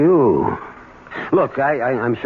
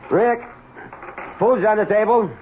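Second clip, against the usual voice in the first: raised calling voice, pitched high; 11 semitones higher than usual. Acted. Wife calling her husband to dinner, raised voice. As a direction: call across the house.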